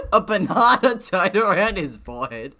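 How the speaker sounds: reading through loud real laughter; laughing. Real, not acted. Laughing at having read bandana as banana.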